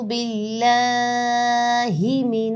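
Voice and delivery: very slow, long melodic tajweed recitation; Quranic recitation. Acted. Teacher starts the lesson with ta'awwudh, slow melodic recitation. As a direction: draw each word out in a sung recitation.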